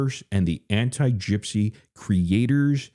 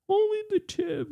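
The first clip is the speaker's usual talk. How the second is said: very high squeak. Pretend. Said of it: "repeats much higher".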